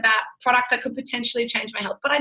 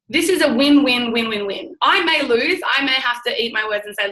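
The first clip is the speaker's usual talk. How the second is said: ordinary talk, nothing marked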